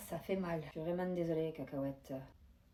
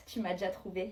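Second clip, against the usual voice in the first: usual speaking voice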